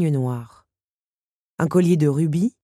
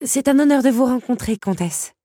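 voix de Belle; 8 semitones higher, 5 dB louder than usual. A narrator's reading. Belle salue la comtesse.

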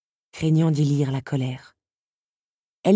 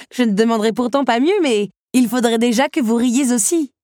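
higher, lively retort; amused young woman; 6 semitones higher, 4 dB louder than usual. A narrator's reading. Belle teasing the beast to laugh too, amused. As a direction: retort high and playful.

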